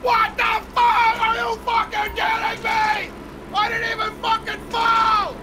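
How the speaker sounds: real rage after getting launched; raging rant. Real, not acted. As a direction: blow up like a conspiracy talk-show host. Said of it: "you sound like Alex Jones of Infowars".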